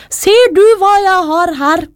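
high, sing-out character voice; mother's proud reveal. A narrator's reading. Mother shows Min Chao the hairpin, high revealing voice. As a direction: show off a secret creation.

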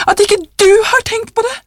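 put-on high, frantic old wife; hysterical. A narrator's reading. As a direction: exclaim frantically, pitch soaring. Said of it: "sa hun hysterisk".

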